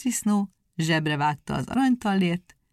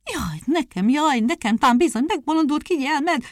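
higher, louder lament, building; wailing; 8 semitones higher, 6 dB louder than usual. A narrator's reading. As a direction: wail in despair as the wife. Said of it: "óbégatott az asszony".

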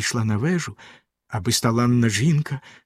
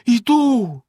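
much higher, louder despairing cry; 10 semitones higher, 5 dB louder than usual. A narrator's reading. Wife answers Bluebeard in despair, high and loud. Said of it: "розпачливо сказала дружина".

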